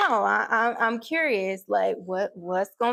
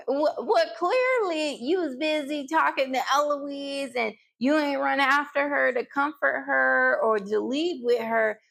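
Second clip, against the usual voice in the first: ordinary delivery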